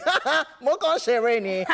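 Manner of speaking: high, loud laughing quote; mock playful laughing. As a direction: act out brushing it off with a laugh. Voicing actor joking after crying.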